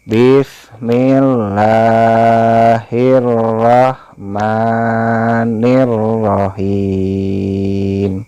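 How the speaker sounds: slow, drawn-out chanted basmala; Quran recitation. Shown as a demonstration. Teacher opens the recitation, slow chant. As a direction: chant it slowly like tilawah.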